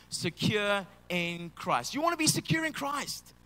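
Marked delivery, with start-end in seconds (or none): 0.1-1.9 slow call-and-response. Shown as a demonstration. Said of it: "Everyone say this with me now".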